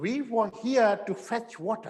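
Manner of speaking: very slow, each word apart; slow drill for the class to repeat; slow model line. Shown as a demonstration. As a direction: say "We were here to fetch water" slowly, r silent. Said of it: "please बोलिए मेरे साथ पीछे".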